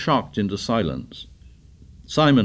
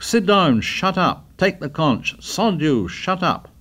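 shouting; 8 semitones higher, slower than usual. A narrator's reading. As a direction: yell over the uproar. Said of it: "Ralph shouted".

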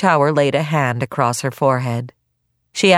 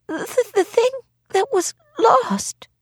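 tearful; slower than usual. Acted. Feverish Granny near tears.

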